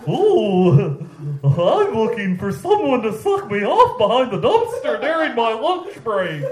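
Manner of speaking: high, silly clown voice. Acted. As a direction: read it like a goofy clown. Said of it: "in a clown voice".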